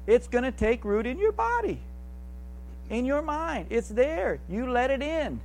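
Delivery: high, building preacher's warning; preaching. Acted. Preaching rises in pitch.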